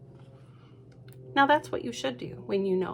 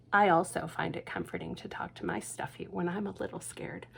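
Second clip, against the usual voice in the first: hushed aside to the listener; whispered confidence; 2 semitones lower, 7 dB quieter than usual. A narrator's reading. Reader confiding to the child about scary feelings. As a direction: share it in a soft whisper.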